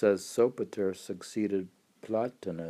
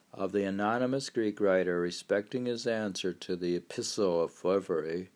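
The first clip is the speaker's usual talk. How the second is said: formal read-out, opening on a high held chant; reading aloud; 5 semitones higher, 4 dB louder than usual. Acted. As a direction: read an old text grandly, starting high and intoned.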